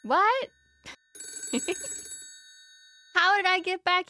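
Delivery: real confusion breaking out at the screen; stunned disbelief. Real, not acted. Suddenly sent back in game.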